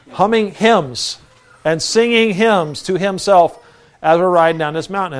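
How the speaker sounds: ordinary delivery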